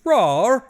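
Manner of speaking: loud, high pretend monster roar; hammed-up roar with a British twist; mock British roar. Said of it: "like, a British accent too".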